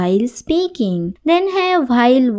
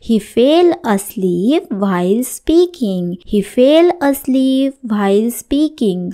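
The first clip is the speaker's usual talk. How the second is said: very slow, every word laid out clearly; slow model sentence; much slower than usual. Shown as a demonstration. Teacher reading the example sentence to students, very slow. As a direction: say it slowly so learners can follow. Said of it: "teacher models English sentence".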